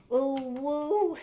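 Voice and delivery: loud, high drawn-out ooh-woo; high "ooh-woo" whoop. Real, not acted. Streamer breaking off the language rant, high whooping noise. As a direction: let out a high, rising woo.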